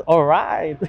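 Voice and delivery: "Alright!" tossed off low and playful; mock catchphrase. Rider jokes about leaving the restroom, mock alright. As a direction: imitate the other riders' habit, lightly.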